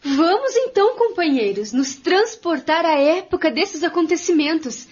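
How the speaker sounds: high, slow announcer voice over music; announcer intro. Acted. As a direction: lead the listeners into the past, slowly. Announcer opens the episode. (locutor de abertura, voz alta e lenta)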